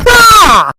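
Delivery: high startled shriek at the snap; sudden loud shriek; 1 startled scream. Real, not acted. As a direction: jump and yelp as the trap fires. Trap snaps, startled scream.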